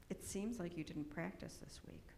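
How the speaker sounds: soft, breathy, hushed teacher; gentle whisper. Retold or imitated. Gentle piano teacher whispering to a child. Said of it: "in that tone of voice".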